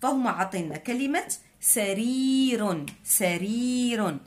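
سرير drawn out slowly for the class; teacher modelling the word; slow demonstration. Shown as a demonstration. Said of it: "نطبقوا هادشي اللي تعلمنا".

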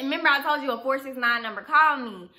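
normal talking voice

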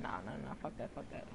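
breathy under-the-breath "fuck that"; hushed muttered nope; whispered refusal. Real, not acted. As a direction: say it under your breath, backing off. Backing out, muttering to self.

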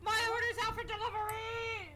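high, slow excited announcement; thrilled gasp of news. Real, not acted. Gasps, food arriving.

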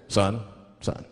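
low, measured traffic-cop voice; police officer. Acted. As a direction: question a driver in a deep officer's voice. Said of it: "If the police pull me over".

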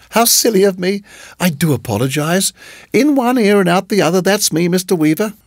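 high, lilting apology; scatty hostess tutting at herself; flustered landlady. A narrator's reading. Landlady apologizes for wrong name.

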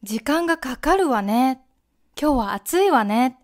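美味しいわね with わね rising. Shown as a demonstration. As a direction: ladylike rising わね at the end. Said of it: "すごく女の人に聞こえます".

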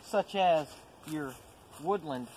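ordinary delivery

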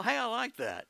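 usual speaking voice

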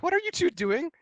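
imitated high-pitched startled question; mom catching them. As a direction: mimic a mom's high, questioning voice.

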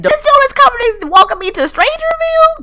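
loud, very high squeal; alarmed disbelief. Real, not acted. Player sees odd dude coming to welcome her, alarmed squeal.

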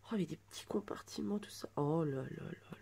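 soft delighted whisper. Real, not acted. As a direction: breathe it out in quiet wonder. Découvre les compartiments, chuchote. (murmure émerveillé chuchoté)

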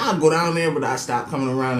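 ordinary delivery

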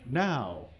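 ordinary talk, nothing marked

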